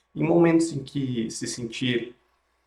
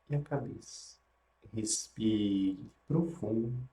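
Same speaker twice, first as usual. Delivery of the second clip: guided meditation; 3 dB quieter than usual. Acted.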